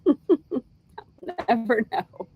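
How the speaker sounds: slow words drawn out through laughter; laughing. Real, not acted. Host laughs about keeping her phone on Paris time, laughing. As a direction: giggle your way through it.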